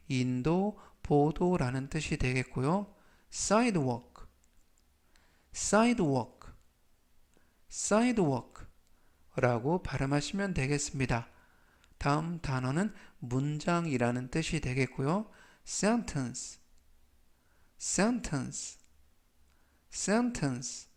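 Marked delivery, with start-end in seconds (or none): 3.3-8.7 sidewalk read slowly, each sound clear; slow model reading. Shown as a demonstration. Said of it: "제가 천천히 세 번씩 읽어드리고요". 15.7-18.8 sentence said slowly and clearly; slow model reading. Shown as a demonstration. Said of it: "제가 천천히 세 번씩 읽어드리고요".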